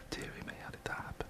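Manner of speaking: fast breathy whisper under the breath; whispered aside. Real, not acted. Aside while explaining producer's absence.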